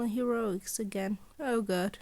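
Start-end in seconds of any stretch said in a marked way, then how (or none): none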